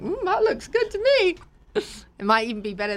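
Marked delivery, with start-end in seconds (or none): 0.0-1.4 high, dreamy mmm of wanting a massage; mock envious, pitched high; longing. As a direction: swoon over the tool. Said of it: "And I was like".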